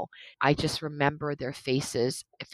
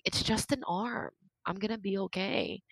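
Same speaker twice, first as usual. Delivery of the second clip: reassuring; 3 semitones higher, 8 dB quieter than usual. Retold or imitated. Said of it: "trying to make them feel better".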